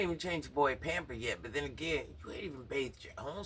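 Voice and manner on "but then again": ordinary delivery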